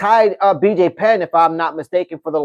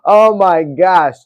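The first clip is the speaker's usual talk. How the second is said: ordinary delivery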